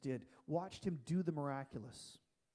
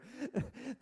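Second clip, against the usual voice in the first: brief amused laugh, a little higher; short real laugh; 6 semitones higher than usual. Real, not acted. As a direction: let out a quick genuine laugh. Laughing at a question from the congregation.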